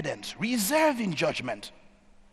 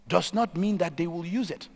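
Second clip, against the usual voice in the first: ordinary talk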